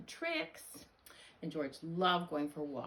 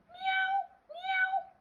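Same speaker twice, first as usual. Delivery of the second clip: very high, louder meows; cat meow; 5 dB louder than usual. Acted. Dog George answering the vet, high cat meows. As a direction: mew sharply like a kitten. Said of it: "And George went".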